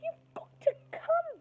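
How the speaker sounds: very high, loud, slow wailing demand; a girl stamping her foot and wailing; whiny tantrum. Acted. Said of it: "Angela stamped her foot".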